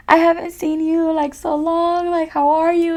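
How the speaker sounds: crying mom. Retold or imitated. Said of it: "she's crying, and she's like".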